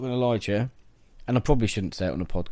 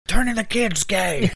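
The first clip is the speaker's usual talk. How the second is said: mock rant voice; 10 semitones higher, 4 dB louder, slower than usual. Echoes the frog rant voice.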